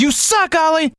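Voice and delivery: high-pitched exasperated outburst. Acted. Host to hopeless sidekick, high exasperated scolding. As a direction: scold the sidekick at your wits' end.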